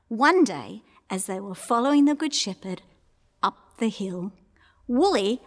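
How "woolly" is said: plain reading voice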